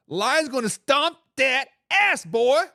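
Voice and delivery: slow, emphatic mock fan boast; hammed-up trash talk. Host voicing a Lions fan's comment, hammed-up trash talk. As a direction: punch each word out slowly as playful trash talk.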